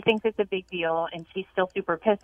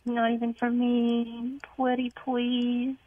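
slow, higher wheedling, drawn-out words; coaxing plea; 4 semitones higher, much slower than usual. Acted. Girlfriend coaxing boyfriend into baby talk on the phone, wheedling. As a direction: beg sweetly with stretched-out words.

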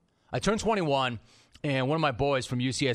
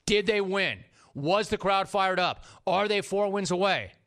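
insistent, pitched-up challenge; emphatic challenge; 5 semitones higher than usual. Real, not acted. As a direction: hammer each question in defence. Rhetorical questions defending the singer.

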